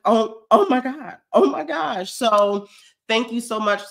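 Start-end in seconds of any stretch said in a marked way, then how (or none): 0.0-2.1 higher, louder gasping oh my God with a laugh; retold shock. Recalling the surprise merch orders to viewers, laughing shock. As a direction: replay the moment the orders came in, laughing. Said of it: "And I was shocked".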